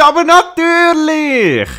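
high laughing sing-song; laughing mock yes. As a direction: squeal it through laughter. Mocking tutorial again, laughing.

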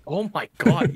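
high, loud startled cry; shocked outburst. Real, not acted. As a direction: blurt it out in shock.

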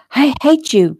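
high, mildly tearful outburst; sobbing. A narrator's reading. Fiancée ends the call after being jilted, sobbing. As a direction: fling it at him through a sob. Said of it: "she sobbed".

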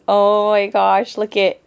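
usual speaking voice

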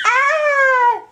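high playful shriek of fright; mock scream. Mother pretending her child is so scary, playful scream. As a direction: scream as if startled, in play. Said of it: "You're so scary".

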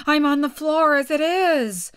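high, loud protest; high, aggrieved Hatter voice. A narrator's reading.

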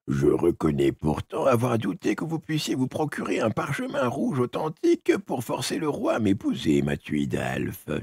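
put-on a quiet scheming old witch. The witch speaks.

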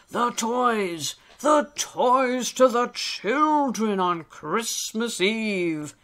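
put-on hearty old elf. Old elf explains Christmas deliveries to Alistair, hearty. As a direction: spell it out with jolly emphasis.

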